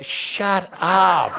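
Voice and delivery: high, drawn-out comic yell; mock exasperated shout. Teacher cutting off the worrying brain, mock shout.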